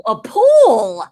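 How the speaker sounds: slow, loud dawning realisation; northerner finally understanding. Retold or imitated. Northerner finally understands the word, drawn-out imitated realisation. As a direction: draw out pool as it clicks. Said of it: "somebody from up north".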